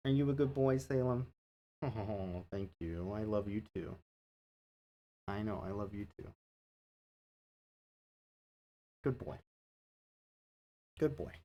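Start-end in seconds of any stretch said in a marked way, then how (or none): 1.8-11.3 soft, murmured praise for the cat; softly love on your pet; gentle quiet pet praise; tender cat talk. Real, not acted. Cuddling the cat.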